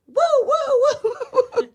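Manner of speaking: high wailing siren whoops; imitated car alarm. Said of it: "you hear this car alarm go off".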